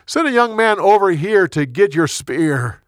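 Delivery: calling out. A narrator's reading. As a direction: declare it across a distance. David still calling over to Saul.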